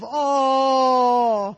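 long, high crowd "aww" that swells and fades; crescendo and decrescendo groan. A narrator's reading. Crowd watching the kick fall short, swelling groan. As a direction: let a groan swell, then die away. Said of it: "a crescendo and decrescendo wave".

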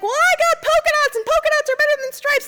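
high cartoon voice bragging; mother voicing a bragging dress to her daughter; boastful dress voice. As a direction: brag like a toy dress.

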